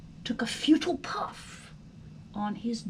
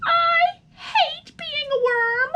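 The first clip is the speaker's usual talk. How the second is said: high, loud, whining tantrum of a fed-up little worm; whiny worm outburst; 11 semitones higher, 7 dB louder than usual. Acted. Son complains to his parents at dinner, loud whiny tantrum. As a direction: wail it like a sulking child who hates everything. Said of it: "exhausted by his outburst".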